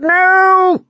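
very high, loud drawn-out cry; anguished shout. A narrator's reading. Prisoner shouting as the door slams, drawn-out and loud. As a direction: wail it at the door. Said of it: "he shouted".

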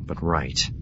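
plain reading voice

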